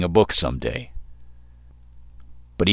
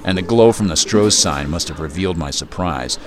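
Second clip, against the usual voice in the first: ordinary delivery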